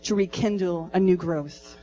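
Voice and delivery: rally the women, full force; Holy Spirit fire preaching to the women; fiery preaching. Acted.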